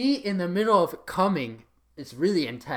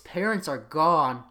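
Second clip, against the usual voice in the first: ordinary talk, nothing marked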